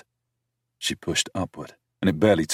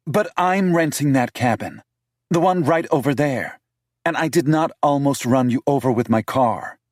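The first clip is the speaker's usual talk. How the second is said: higher voice for the city woman, indignant; higher-pitched female character, accusing; 9 semitones higher, 5 dB louder than usual. A narrator's reading.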